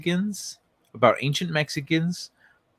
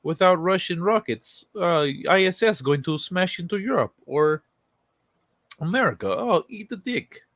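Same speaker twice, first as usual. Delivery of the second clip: mocking Rogozin; 6 semitones higher than usual. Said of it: "He's like".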